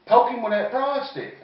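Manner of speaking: high, loud barked command; loud order. Retold or imitated. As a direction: bark the order at the boy. Daddy orders the boy.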